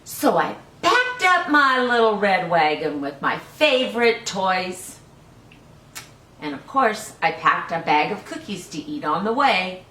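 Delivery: put-on lively read-aloud for small children; storybook reading. Teacher reading a picture book to children, lively.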